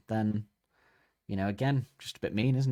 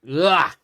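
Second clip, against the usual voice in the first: loud, higher "Ugh!" of disgust; disgusted tree groan; 7 semitones higher, 10 dB louder than usual. Retold or imitated. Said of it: "the tree just, just goes".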